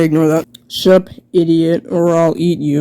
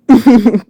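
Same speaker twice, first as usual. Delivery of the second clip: short laugh; 5 semitones higher, 5 dB louder than usual. Real, not acted. Laughs at own voice.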